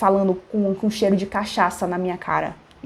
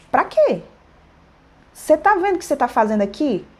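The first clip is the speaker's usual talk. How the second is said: raised, fast confrontation; indignant scolding; 4 semitones higher than usual. Retold or imitated. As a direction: tell him off, pitch climbing on the questions. Acted telling-off of the shouting customer.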